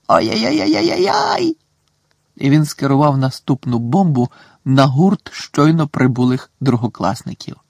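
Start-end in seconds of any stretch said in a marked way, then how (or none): 0.1-1.6 very high, shouted gleeful "ой-ой-ой"; shrieking poltergeist cackle. A narrator's reading. Peeves shrieks with glee bombing the second-years.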